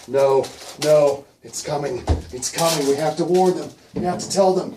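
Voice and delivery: loud, rising frantic warning; panicked Fiver. Acted. Storyteller as Fiver begging to warn the warren, panicked. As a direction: climb in pitch and volume, desperate to warn everyone.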